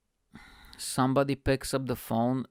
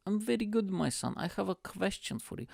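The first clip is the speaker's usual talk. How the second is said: put-on high, softer Bulgarian-accented dad voice; imitated Bulgarian father; dad's Bulgarian accent; 8 semitones higher, 4 dB quieter than usual. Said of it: "And he's like".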